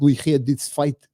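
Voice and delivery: slow Russian accent, second attempt; retry of the Russian accent. Vlogger redoing his doubtful Russian accent impression, slow Russian accent. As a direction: try the Russian accent again, slowly. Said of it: "I don't know if that was a good Russian accent".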